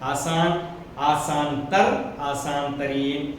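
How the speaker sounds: slow, word by word आसान, आसान तर, आसान तरीन; teacher spelling out the degrees slowly; slow dictation. Shown as a demonstration. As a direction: read the forms out slowly for the class. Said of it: "देखिए box में है".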